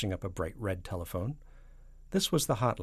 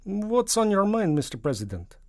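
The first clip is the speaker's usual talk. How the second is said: Soviet premier; 12 semitones higher, 9 dB louder than usual. Acted. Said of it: "said the voice from Moscow".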